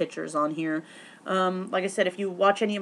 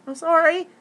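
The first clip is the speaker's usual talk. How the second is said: mock scared apology; 10 semitones higher, 7 dB louder than usual. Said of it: "and I got a little scared".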